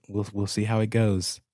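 ordinary talk

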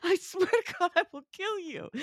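high, hammed-up screaming threat; imitating the director's high shriek; mock furious director. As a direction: parody the director's rage.

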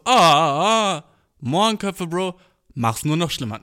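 theatrical groan at the word; mock exasperation. The version he didn't say, theatrical exasperation. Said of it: "Nein, hab ich nicht gesagt".

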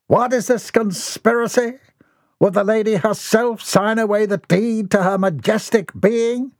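high, theatrical protest; scandalised, grand old fool; lofty outrage at the contract; indignant eccentric. A narrator's reading.

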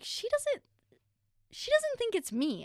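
very high-pitched, disbelieving retold thought; dawning realization. Daughter recalling her thought on being mistaken for sister, high-pitched disbelief. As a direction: squeak out a stunned realization.

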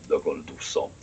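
low, quiet, toneless deadpan. Retold or imitated. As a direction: drop it low and dry. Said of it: "in his deadpan voice goes".

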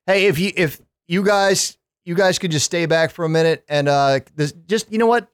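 high, breezy casual president; JFK impression. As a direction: toss it off like a carefree president. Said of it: "no way J F K had that mindset".

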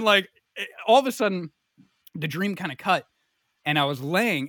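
ordinary delivery

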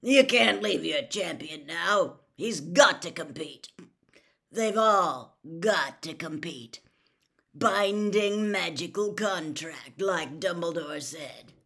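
loud, slow growl; growled, emphatic warning. A narrator's reading. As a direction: growl it out deliberately, leaning on the key words. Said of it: "growled a voice from near the door".

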